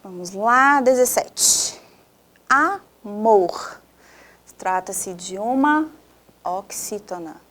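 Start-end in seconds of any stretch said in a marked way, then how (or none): 2.5-3.8 AMOR split as a-mor, louder; syllable by syllable. Shown as a demonstration. Teacher running a stress game, Amor syllable by syllable. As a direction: sound out "Amor" in two separate, drawn-out syllables.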